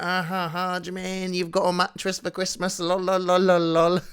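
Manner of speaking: high, sing-song sibling taunt; teasing. Retold or imitated. Child teasing older brother about a mattress present, high mocking taunt. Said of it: "I love teasing him".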